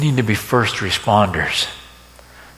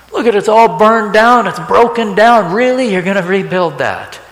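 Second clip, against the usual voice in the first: much higher and louder, jeering; mocking shout; 9 semitones higher, 6 dB louder than usual. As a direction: yell it in scornful disbelief. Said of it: "And he said in".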